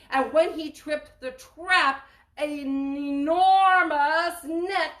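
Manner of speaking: loud, slow suspense; dramatic build. A narrator's reading. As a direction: draw out the trap moment. Dramatic trap moment.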